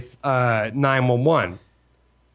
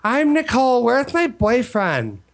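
put-on dazed girl; 7 semitones higher, slower than usual. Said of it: "she was like".

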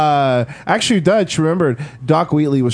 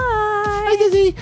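much higher, sing-song greeting; squeaky high hello; much slower than usual. Pretend. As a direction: pop it up into falsetto. Greets Jessie again in falsetto.

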